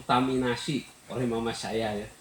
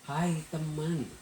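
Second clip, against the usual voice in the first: slow, higher, tender; gentle greeting; 6 semitones higher, much slower than usual. Retold or imitated. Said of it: "Tuhan Yesus bilang".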